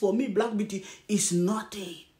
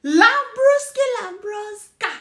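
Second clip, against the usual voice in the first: mocking call; 7 semitones higher, 3 dB louder, slower than usual. Real, not acted. Mocking a rival vlogger.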